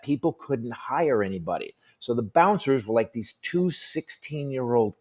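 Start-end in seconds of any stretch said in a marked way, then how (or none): none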